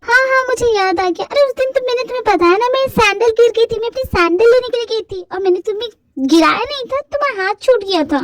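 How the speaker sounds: put-on high girlish annoyed voice; exasperated heroine. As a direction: scold him in disbelief. Heroine explains bridge incident.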